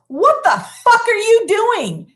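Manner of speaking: mock the singer's sassy comeback. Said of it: "And got all sassy".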